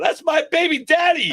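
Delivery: very high, loud, drawn-out squeal; hammed-up falsetto shriek, stretched slow; mock clamoring woman. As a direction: imitate a woman crying out a claim. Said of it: "I'd have all these women going".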